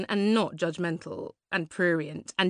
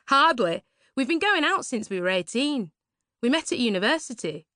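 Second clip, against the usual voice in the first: put-on a lightly raised, dry young woman; a touch higher, matter-of-fact; Laurie's voice; 6 semitones higher than usual. Answering Suzanne's nosy question, lightly voiced and dry.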